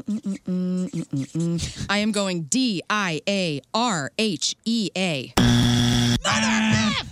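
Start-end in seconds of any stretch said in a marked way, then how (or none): 5.4-7.0 drawn-out angry yell; slow, loud frustrated outburst; frustrated shout. Real, not acted.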